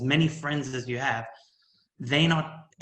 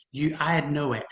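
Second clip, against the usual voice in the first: ordinary talk, nothing marked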